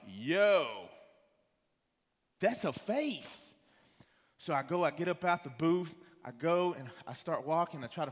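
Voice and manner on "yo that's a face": startled. Retold or imitated. Shocked at face on camera.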